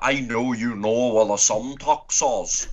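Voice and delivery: slow, drawled Northern Irish impression; Northern Irish accent. Imitating Peaky Blinders, drawled Northern Irish accent. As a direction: draw out the Northern Irish vowels. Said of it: "Have you seen Peaky Blinders?".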